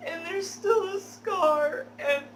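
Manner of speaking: high, loud fake sobbing with laughter breaking through; fake crying through laughs. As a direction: ham up the fake tears, barely holding a laugh. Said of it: "fake tears that I can create".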